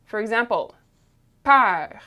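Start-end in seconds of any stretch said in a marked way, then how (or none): 1.4-2.0 "peur" with open eh-like eu and French R; open vowel demo. Shown as a demonstration. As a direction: model "peur" loudly, vowel open and held. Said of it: "this one is gonna sound more like".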